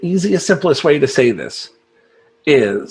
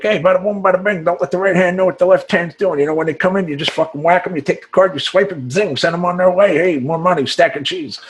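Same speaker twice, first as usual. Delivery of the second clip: rapid-fire wiseguy patter, pitched up; Italian hustler; 4 semitones higher, faster than usual. Acted. Salesman mocking his own Italian side, hustler patter. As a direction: play an Italian hustler running through the scam. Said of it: "Or the Italian, like".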